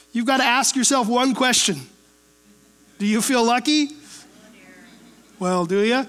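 Dirty Harry. Retold or imitated. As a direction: quote the movie cop, low and menacing. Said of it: "Dirty Harry-ish a little bit".

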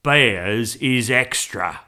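loud, very slow gruff cabbie; growled. A narrator's reading. Taxi driver charging for a bear, growling. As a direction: growl it out slowly and loudly. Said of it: "he growled".

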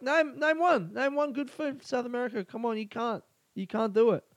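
pitched high, goading challenge; mock insistent friend. Retelling friend goading the group, high mocking challenge. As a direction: needle them like a smug mate. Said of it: "He's like".

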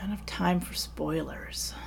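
whispered, musing to herself; whispering. Real, not acted. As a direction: whisper the phrase back thoughtfully. Repeats question to herself quietly.

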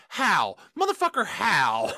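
usual speaking voice

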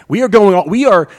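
pressing the point home with passion; fired-up preaching. Real, not acted. Preacher urging the church, loud and fired up. Preacher stressing total commitment.

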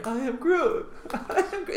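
high, laughing acted line; mock-wounded high take; Groot. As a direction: play the provoked actor, half laughing. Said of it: "when you say, "I am Groot".

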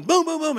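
high, loud booms in a burst; imitated banging. Telling of noise at five A M, loud mock banging. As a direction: mimic hard pounding on a door. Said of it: "just keeps going".

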